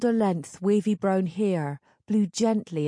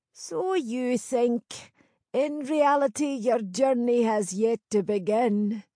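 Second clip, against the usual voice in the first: put-on higher-pitched old crone; knowing old village crone; 5 semitones higher than usual. Crone foretells.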